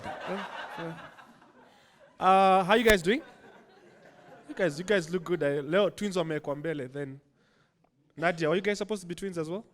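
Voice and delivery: ordinary delivery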